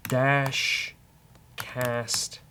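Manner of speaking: ordinary talk, nothing marked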